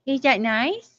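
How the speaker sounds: very high squeaky hi to the dog. Real, not acted. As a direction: squeak it up like greeting a puppy. Greeting dog in baby voice.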